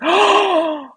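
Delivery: high, loud gasped "oh"; excited gasp. Retold or imitated. Reliving her find of the list, excited gasp. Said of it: "I was so excited".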